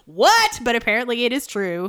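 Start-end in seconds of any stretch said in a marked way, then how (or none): 0.1-0.6 high, loud disbelieving yelp; shocked squeak of disbelief; incredulous what. Retold or imitated. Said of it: "Sarah and I were like".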